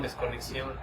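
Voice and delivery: calm, unhurried guiding voice with long pauses; guided meditation. Acted. Teacher guiding students through a voice-tone exercise, meditative. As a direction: lead the group inward through the exercise, gently.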